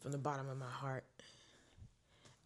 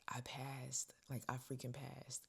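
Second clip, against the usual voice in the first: whisper; 8 dB quieter, slower than usual. Retold or imitated. Calling sister with news.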